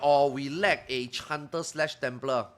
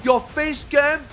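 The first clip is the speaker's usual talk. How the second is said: slow, higher, louder mock fan question; mocking Russian viewers; 9 semitones higher, 6 dB louder, slower than usual. Streamer mocks fans asking about his face cam, slow mock voice. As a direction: drag it out like a clueless fan. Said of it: "then you're like".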